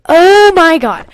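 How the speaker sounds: slow, loud oh my God; drawn-out exasperated exclamation. Real, not acted. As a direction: groan at the writing. Exasperated outburst.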